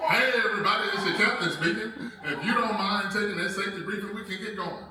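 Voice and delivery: usual speaking voice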